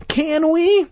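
put-on high, pushy kid's question; demanding child. A narrator's reading. Boy pressing Dad again, demanding. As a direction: pester with a high, insistent voice. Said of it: "Luke demanded".

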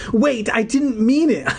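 high pleading cry. A narrator's reading. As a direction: call after her desperately. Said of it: "I cried".

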